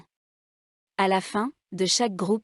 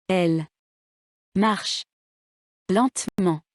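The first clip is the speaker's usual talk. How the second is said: Elle, marche, lentement each stressed alone, long pauses between; word by word. Shown as a demonstration. Teacher showing written words one at a time, word by word. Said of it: "Faites attention à l'accent tonique".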